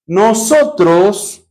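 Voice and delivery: "nosotros" very slow and drawn out; stress on nosotros. Shown as a demonstration. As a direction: lean on "nosotros", stretching it. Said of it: "nosotros lo que". (alargando mucho "nosotros")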